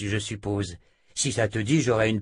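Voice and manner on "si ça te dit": usual speaking voice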